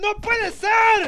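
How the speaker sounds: high, slow drawn-out cry of disbelief; disbelieving shout. Real, not acted. Cohost echoing the disbelief, high and slow. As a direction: stretch it out in mock agony.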